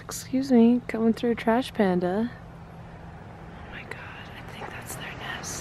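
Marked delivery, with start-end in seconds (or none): 2.3-5.6 very soft, real whisper; hushed, barely audible; amazed whisper. Real, not acted. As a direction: whisper the discovery under your breath. Hushed discovery near the raccoons.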